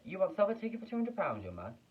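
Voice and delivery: high, loud eager voice; eager buyer. Acted. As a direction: press the boy eagerly.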